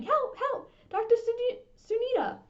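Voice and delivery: high, loud desperate calls; shouting for help. Acted. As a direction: yell across the field for help. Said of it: "all the time shouting".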